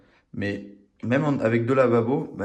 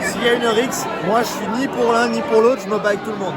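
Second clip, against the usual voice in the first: higher, louder, mock heroic; loud declaration; 12 semitones higher, 6 dB louder than usual. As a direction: proclaim it like a rallying cry.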